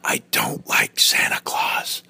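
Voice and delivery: slow, breathy whisper into dad's ear; whispered confession. Retold or imitated. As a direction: whisper the secret slowly, like a cheeky toddler. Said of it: "he would whisper in my ear".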